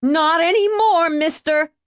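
very high, shrill retort; high-pitched outraged screech; shrieked. A narrator's reading. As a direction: shriek it down at him. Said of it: "she shrieked down".

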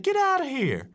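high, astonished outburst; couldn't believe the news; stunned disbelief. Retold or imitated. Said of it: "And I said".

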